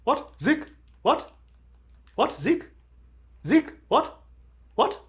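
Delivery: short high yelps of what and the name; imitating a friend. Showing the friends how the guy sounded, high clipped calls. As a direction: do his sharp, high calls. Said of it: "this is how he sounded".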